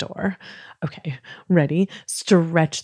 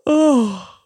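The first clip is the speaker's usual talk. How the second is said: gasping in, then a higher, louder yawned "oh"; a big theatrical yawn; big pretend yawn; 6 semitones higher, 5 dB louder than usual. Shown as a demonstration. Host shows children a pretend yawn, big yawning sound. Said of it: "Let's hear you yawn".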